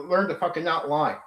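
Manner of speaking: ordinary delivery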